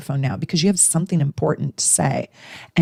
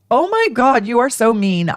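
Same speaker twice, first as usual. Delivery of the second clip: offended gasp; 6 semitones higher than usual. Acted. Acted offended friend.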